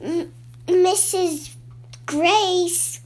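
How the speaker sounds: calling out. A narrator's reading. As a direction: call out the name, drawing it out. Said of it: "he called to the farmer".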